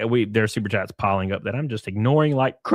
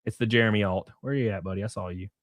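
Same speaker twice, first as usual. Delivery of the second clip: ordinary talk, nothing marked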